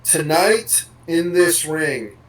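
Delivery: slow, spaced-out wrestler catchphrase; stock promo cadence. Retold or imitated. Host mimics wrestlers' overused phrase, slow monotonous promo cadence. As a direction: imitate a scripted promo line, word by measured word. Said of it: "hear this phrase".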